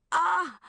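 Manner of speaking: a sudden high, loud yelp; startled cry. A narrator's reading. Woken by the alarm, a startled high cry. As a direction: jolt awake with a cry.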